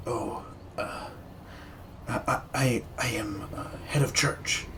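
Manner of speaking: soft, breathy, halting reply; startled whisper. Acted. Priest surprised by visitors, whispering nervously. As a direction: barely voice it, caught off guard.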